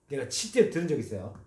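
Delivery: ordinary talk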